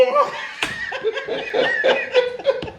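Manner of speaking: very high airless laugh; high shrieking laugh. Real, not acted. Laughing at his father's retort, high shriek.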